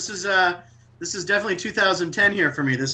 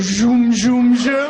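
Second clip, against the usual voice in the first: mock lightsaber zoom; 4 semitones higher, 7 dB louder, much slower than usual. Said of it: "they come up and be like".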